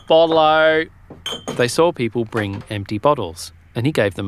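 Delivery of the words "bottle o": louder, higher street cry; old bottle man calling out; vendor's shout. Retold or imitated. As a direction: bellow the bottle call. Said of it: "When he shouted".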